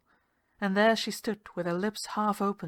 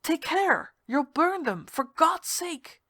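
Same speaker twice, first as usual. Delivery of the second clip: much higher, louder, slow frightened cry; much higher panicked warning; alarmed cry; 9 semitones higher, 5 dB louder, slower than usual. Acted. I gave a gasp.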